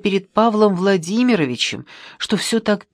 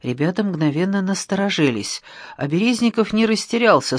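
plain reading voice

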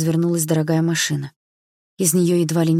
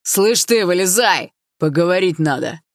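louder rough demanding shout; bully her out of the car; angry yell; 2 semitones higher, 5 dB louder, slower than usual. A narrator's reading. Ex yells at the girl to get out, angry shout. Ex keeps yelling.

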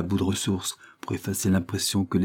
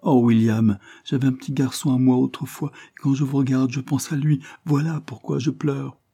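put-on higher-pitched confession to a child; a touch of grief, voice lifted above narration; tearful governess; 6 semitones higher than usual.

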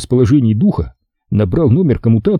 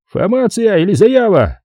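high-pitched brusque phone operator; high-pitched bureaucrat on the line; Brooklyn police voice; 11 semitones higher than usual. A narrator's reading. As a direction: ask it high, in garbled officialese. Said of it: "спросил голос".

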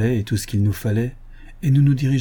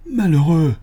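sharp, louder exclamation; cry of alarm; 6 semitones higher, 5 dB louder than usual. Acted. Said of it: "M'écriai-je". (s'écrier, bouleversé)

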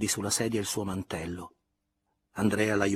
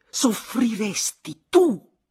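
higher, louder, slow choked cry; stifled cry; 13 semitones higher, 9 dB louder, much slower than usual. A narrator's reading. Elena crying out at Andrea, stifled. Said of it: "gridò ella con voce un po' soffocata".

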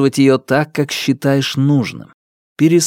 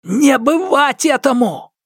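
high, ringing defiant cry; 13 semitones higher than usual. Acted. Hero defies the darkness.

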